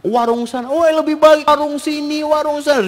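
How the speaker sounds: high-pitched mock bickering; squabbling couple. Husband and wife arguing over food stalls, mock squabble.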